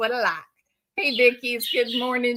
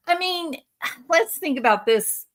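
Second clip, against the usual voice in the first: normal talking voice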